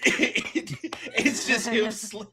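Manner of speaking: ordinary delivery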